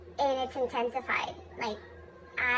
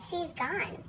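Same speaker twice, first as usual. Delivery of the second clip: usual speaking voice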